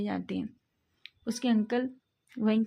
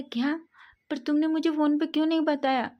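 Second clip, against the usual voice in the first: higher-pitched, louder surprised question; 6 semitones higher, 4 dB louder than usual. Acted. Friend learns Wing was injured and never told him, surprised and higher. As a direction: caught off guard and a bit hurt, pitch jumps up.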